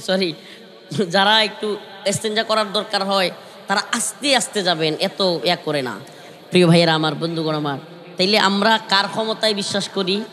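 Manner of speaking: normal talking voice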